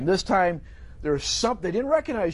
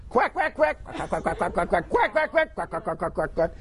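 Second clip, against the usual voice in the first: chattering little duck noises; little duckies quacking. Acted. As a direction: quack like ducklings in a line. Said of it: "like little duckies following along".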